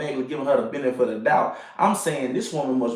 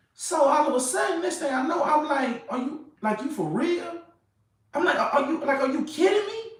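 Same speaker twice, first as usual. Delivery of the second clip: high-pitched incredulous squeak, much higher throughout; stunned disbelief; 12 semitones higher than usual. Retold or imitated. Telling his boys how he reacted to her car, high disbelieving squeal. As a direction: squeal it like you can't believe your eyes.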